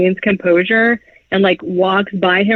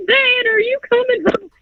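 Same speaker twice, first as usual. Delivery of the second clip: very high-pitched mock child voice; imitated kids' voices. Kids asking their dad a question, exaggerated child voice. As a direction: squeak it out like little kids. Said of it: "They don't talk like that".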